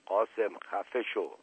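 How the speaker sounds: soft, severe reprimand; quietly vehement, voice held down; stern but hushed. A narrator's reading. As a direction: shut him up firmly under your breath. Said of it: "با تشدد ولی با صدای آهسته گفت".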